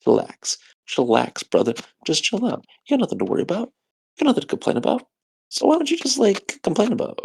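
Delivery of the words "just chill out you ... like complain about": rising pitch. Real, not acted. Rambling pep talk, rising higher.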